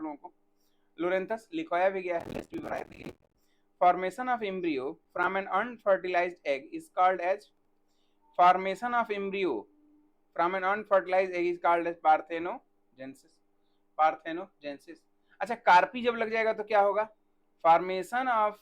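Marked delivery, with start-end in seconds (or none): 3.8-15.0 slow, word by word dictation for notes; teacher dictating a definition, slow and even. Shown as a demonstration. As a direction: spell it out slowly so students can write.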